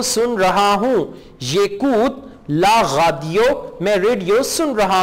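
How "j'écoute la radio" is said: slow model reading. Shown as a demonstration. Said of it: "teacher models French slowly".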